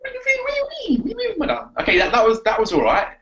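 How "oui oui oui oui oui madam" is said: very high, squeaky mimicked French; mock French accent. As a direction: tease with a piping French accent. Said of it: "like, a French South London".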